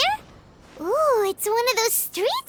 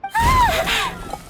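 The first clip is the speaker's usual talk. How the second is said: sharp gasp leaping high and loud; a sudden startled cry from across the room; 12 semitones higher, 4 dB louder than usual. Acted. Ayaka cries out offscreen while the others wait, startled loud gasp. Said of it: "That sounded like Ayaka".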